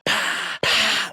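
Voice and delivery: high, loud spluttering pah; spluttering spit-out. Retold or imitated. Retelling spitting out the spicy sweets, high loud spluttering pah. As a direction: spit it out like it burns your mouth. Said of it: "and I was like".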